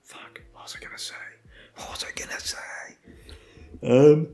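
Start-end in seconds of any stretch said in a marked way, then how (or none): 0.0-2.6 breathy whisper under his breath; a quick whispered aside while losing the thread; whispering to self. Real, not acted. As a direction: mutter it to yourself, barely audible. Muttering to himself, lost thread.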